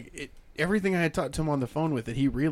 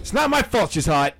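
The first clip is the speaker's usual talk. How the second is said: mock boast; 5 semitones higher, 7 dB louder than usual. Bragging joke.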